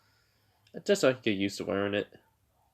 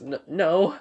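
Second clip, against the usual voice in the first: flustered denial; 6 semitones higher, 4 dB louder than usual. Retold or imitated. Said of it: "she's blushing, right?".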